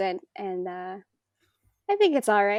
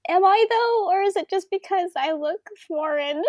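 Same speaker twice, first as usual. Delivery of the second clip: high-pitched, incredulous comeback; mock indignant retort; 9 semitones higher, 5 dB louder than usual. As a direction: protest loudly, playing up the disbelief. Acted indignant reply to critics.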